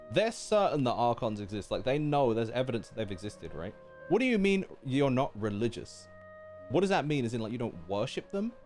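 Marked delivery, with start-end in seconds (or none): none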